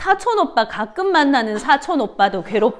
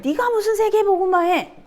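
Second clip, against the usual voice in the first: higher, taunting brother's sneer; mocking sneer; 8 semitones higher than usual. Teacher voicing brothers mocking Joseph's dream, higher sneer. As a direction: scoff at the dream like a jealous sibling. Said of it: "막 이러잖아".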